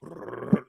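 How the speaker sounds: rolled-tongue drum roll; imitated drum roll. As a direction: trill out the drum roll of an entrance theme. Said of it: "Soon as that drum rolls".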